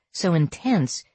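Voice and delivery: ordinary delivery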